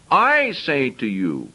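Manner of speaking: slow, "I" stressed, falling; emphasized I. Shown as a demonstration. Preacher repeating Jesus' phrase, slow stress on I. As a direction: spell out each word with weight on "I". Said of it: "now I want to emphasize".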